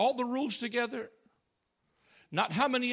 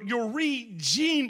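re-gene said very slowly, each part weighed; preacher dwelling on re-gene, very slow; stressed re-gene; much slower than usual. Shown as a demonstration. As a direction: stretch out re-gene and hold the gene. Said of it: "I stress the word gene".

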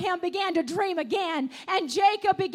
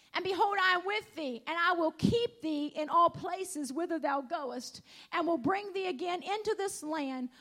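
solemn scripture reading; 3 semitones lower, 4 dB quieter than usual. Acted. Preacher reading Genesis to the congregation, softly reverent. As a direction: read the promise gently, with awe.

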